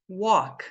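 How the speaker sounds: "Walk" with an open O and silent L. Shown as a demonstration. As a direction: say "Walk" as "wók". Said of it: "vão ter o som de O".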